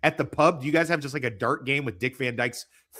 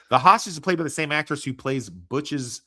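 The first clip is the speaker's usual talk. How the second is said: normal talking voice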